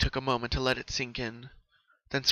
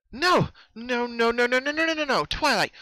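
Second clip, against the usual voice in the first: frantic high shrieking refusal, much louder; panicked no; 12 semitones higher, 8 dB louder than usual. A narrator's reading. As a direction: cry out no over and over in terror.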